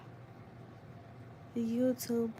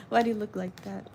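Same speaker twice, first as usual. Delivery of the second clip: normal talking voice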